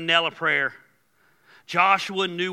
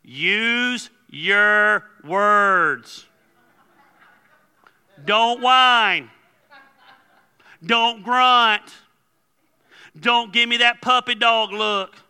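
loud, drawn-out, slow scolding; fed-up parent at high volume; exasperated parent; 5 semitones higher, 6 dB louder, much slower than usual. Acted. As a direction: spell it out to a toddler, word by word. Scolding the toddler with a phrase.

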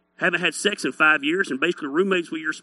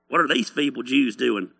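lower, barely disguised scoffer; a half-hearted character voice, pitched down; mocker in own voice; 6 semitones lower than usual. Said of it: "That's my voice. It's the only voice I have".

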